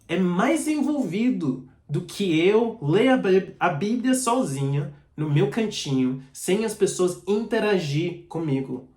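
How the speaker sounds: ordinary talk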